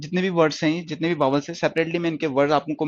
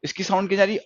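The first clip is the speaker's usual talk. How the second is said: ordinary talk, nothing marked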